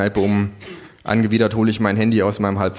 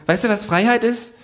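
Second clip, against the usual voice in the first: high, fast kangaroo; 13 semitones higher than usual. A narrator's reading. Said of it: "das Känguru".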